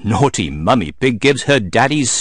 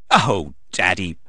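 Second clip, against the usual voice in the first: plain reading voice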